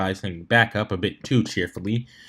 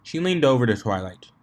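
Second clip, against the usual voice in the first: ordinary delivery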